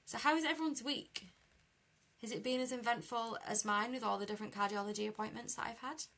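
ordinary talk, nothing marked

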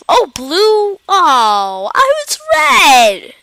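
high, loud cry of realisation; loud dismayed outcry. Real, not acted. Gamer discovers the wrong team colour, loud dismay. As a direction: cry out loud, pitch leaping up. Player reacts to losing the round.

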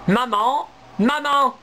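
high, loud calls for mama; squirrels calling mother. Acted. Baby squirrels call loudly to their returning mother. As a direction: cry out gladly to mother. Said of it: "crient les petits écureuils".

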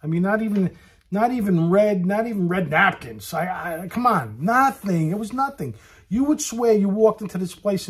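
loud, high disbelief; exasperated rant. Real, not acted. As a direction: hammer every "not even". Ranting about no decorations.

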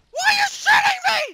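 high, loud panicked yells; panicked yelling. Real, not acted. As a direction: scream for it to stop. Being shot at in game.

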